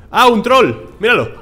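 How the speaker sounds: high, loud excited shout. Real, not acted. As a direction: point it out thrilled and startled. Spots a troll boss.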